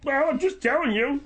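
high-pitched tearful protest; upset, voice pushed up high; bawling. Acted. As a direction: whine it as if about to bawl. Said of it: "don't start bawling".

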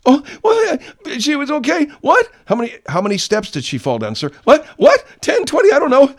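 very high, loud hammed-up hysteria; mock a guilty husband flailing on the phone; shrill fake-frantic caller; Ferguson's faked panic. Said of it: "Same thing with this guy Ferguson".

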